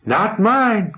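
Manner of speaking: pitched high and sharp, calling across the room; a young woman calling out a quick retort, high; called out. A narrator's reading. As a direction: heckle it out from the crowd, high. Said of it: "called out Caroline".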